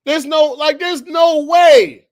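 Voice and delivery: nearly crashing out, let it burst; loud, high, drawn-out disbelief; real outburst. Real, not acted. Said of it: "I'm about to crash out".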